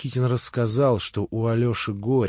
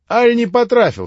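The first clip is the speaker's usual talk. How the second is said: high, loud offended question; touchy retort; 12 semitones higher, 9 dB louder than usual. Acted. Rakitin, stung by Grushenka's complaint, taking offence. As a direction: sound instantly hurt. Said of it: "спросил Ракитин, мигом почти обидевшись".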